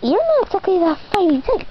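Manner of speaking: usual speaking voice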